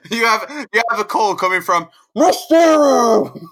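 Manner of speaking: high, loud announcer voice of an operator; phone operator. Pretend. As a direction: announce the caller brightly and loud, like a switchboard. Mock phone operator announcing call.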